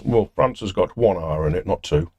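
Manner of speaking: ordinary talk, nothing marked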